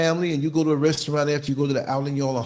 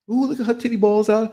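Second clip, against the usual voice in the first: put-on giggly kid; 7 semitones higher than usual. Kids mocking passing woman.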